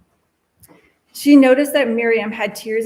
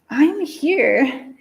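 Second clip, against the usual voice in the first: a lightly voiced echo, higher; echo in her mind; 5 semitones higher, 3 dB louder than usual. A narrator's reading. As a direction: let it float back like an echo. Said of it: "like an echo in her mind".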